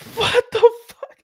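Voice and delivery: high, strained mock wail, drawn out slowly; losing it, retold. Son losing it over torn-down posters, retold meltdown. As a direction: reenact an outraged cry cracking into laughter. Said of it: "Bro, I lost it".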